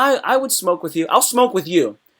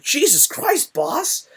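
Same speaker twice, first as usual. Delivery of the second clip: amazed exclamation; 11 semitones higher, much slower than usual. Retold or imitated. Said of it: "You'll be like".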